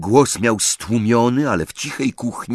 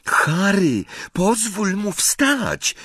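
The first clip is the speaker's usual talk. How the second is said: higher and slow, lightly voiced Hermione; soft girl's plea; 12 semitones higher, slower than usual. A narrator's reading. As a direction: gently ask him to let go. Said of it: "wyszeptała Hermiona".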